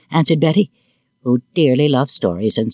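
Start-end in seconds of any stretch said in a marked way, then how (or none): none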